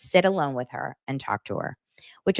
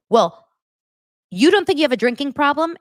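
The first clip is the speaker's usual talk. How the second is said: accusing; 9 semitones higher, 6 dB louder than usual. Retold or imitated. Tamara confronting Shannon, retold.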